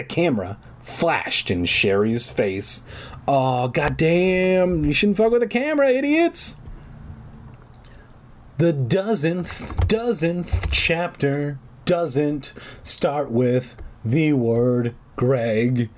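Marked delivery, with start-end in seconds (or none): 3.3-6.6 hammed-up exasperation, rising steeply; mock outburst. Jokey outburst at the characters. 8.6-15.9 word by word, very drawn out; laboriously slow. Acted. Joking slow aside on chapter openings.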